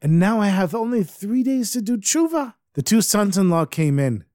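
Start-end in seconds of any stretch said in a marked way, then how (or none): 0.0-2.5 acted frantic despair, pitched way up; going crazy. Fivish realizes he has three days left, high and frantic. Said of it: "he started going crazy".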